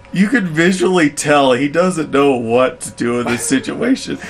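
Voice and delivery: ordinary talk, nothing marked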